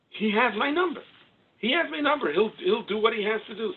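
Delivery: high, loud declaration of faith; impassioned cry. Acted. Preacher declaring his trust in God, high impassioned cry.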